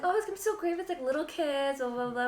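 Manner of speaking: high-pitched gushing anticipation; mock excitement. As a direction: retell your naive hopes in a high voice. Retelling her own hopeful prediction.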